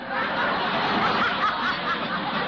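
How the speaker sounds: high, loud gloating laugh; a loud gloating chortle; triumphant high giggle. Acted. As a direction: gloat with a giggle at the judge's expense.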